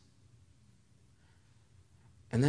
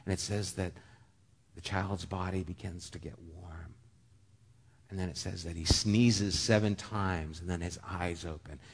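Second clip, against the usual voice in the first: normal talking voice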